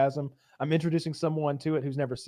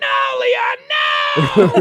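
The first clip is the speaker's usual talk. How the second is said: loud pleading yell; panicked shout; 4 semitones higher, 10 dB louder, much slower than usual. Retold or imitated. Larry begging Leon to stop, loud panicked shout. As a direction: cry out to stop him. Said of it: "he's like".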